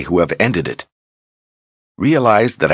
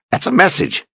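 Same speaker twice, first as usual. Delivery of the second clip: excited; 14 semitones higher than usual. A narrator's reading. Zed bursts out excitedly.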